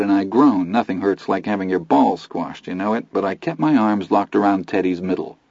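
plain reading voice

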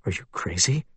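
hushed, low aside; softly. Acted. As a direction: keep it under your breath. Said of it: "Jack said softly".

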